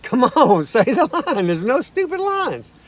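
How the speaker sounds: cheering a shy co-writer on to say it; urging. Retold or imitated. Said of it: "And I said".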